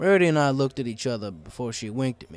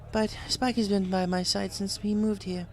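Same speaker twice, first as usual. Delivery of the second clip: higher, playful lady pony; Rarity voice; 8 semitones higher than usual. A narrator's reading. Rarity plays along with the disguised kid, teasing. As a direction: play along sweetly.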